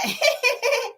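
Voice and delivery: high-pitched fake giggle; put-on tee-hee laugh. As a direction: imitate a phony, high tittering laugh. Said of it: "with that fake laugh".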